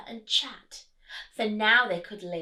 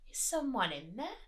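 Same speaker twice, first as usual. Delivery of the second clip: ordinary delivery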